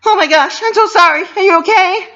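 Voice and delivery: very high, loud panicked cry; frantic apology. A narrator's reading. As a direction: plead sorry in a panic.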